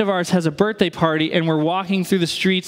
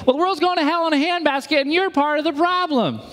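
much higher, mocking a hostile preacher; 10 semitones higher, faster than usual. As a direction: sneer it like a judgmental street preacher. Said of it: "if we meet them with hostility".

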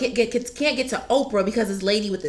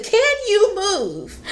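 much higher, slow, fed-up demand; exasperated demand; 11 semitones higher, much slower than usual. Retold or imitated. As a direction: finally snap at the lady blocking Oprah. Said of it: "And I said".

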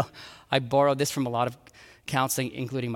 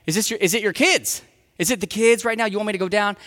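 eager, fixing husband; 4 semitones higher, faster than usual. Acted. Husband offering solutions.

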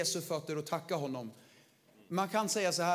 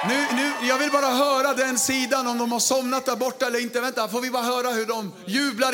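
shouting high and loud to rouse the crowd; loud cheerleader shout; hype shout; 5 semitones higher, 10 dB louder than usual. Real, not acted. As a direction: fire up the room, full voice. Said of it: "Jag gör kaos idag".

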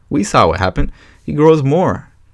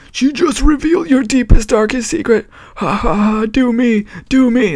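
much higher, put-on mocking cackle voice; imitação de trailer. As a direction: quote the movie line in a high put-on voice. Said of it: "part of the trailer where he's like".

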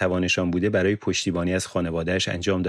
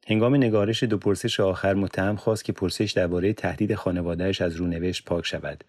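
plain reading voice

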